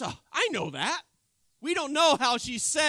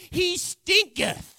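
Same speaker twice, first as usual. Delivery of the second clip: King James line; 7 semitones higher, 6 dB louder, slower than usual. Retold or imitated. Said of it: "In the King James, it goes".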